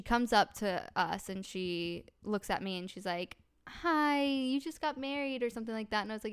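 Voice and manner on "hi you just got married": high-pitched, sugary nice greeting; mock friendliness. Stranger greeting a new bride at the pool, mock friendly. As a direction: imitate a stranger being really nice. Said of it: "being really nice at first".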